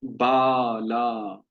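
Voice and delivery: बाला with both vowels stretched slowly; drawn-out बाला, long aa sounds. Shown as a demonstration. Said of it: "आपको समझ में आया?".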